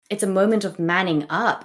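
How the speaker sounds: ordinary delivery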